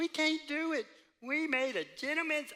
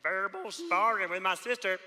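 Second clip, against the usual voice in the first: normal talking voice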